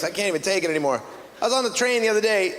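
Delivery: usual speaking voice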